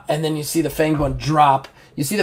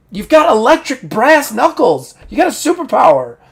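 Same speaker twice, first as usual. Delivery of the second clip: high, louder incredulous scolding; exasperated goading; 11 semitones higher, 6 dB louder than usual. Acted. Game master as Ocelot scolding the big guy, exasperated. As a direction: press the point, voice pitched way up.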